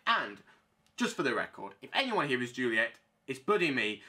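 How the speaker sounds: normal talking voice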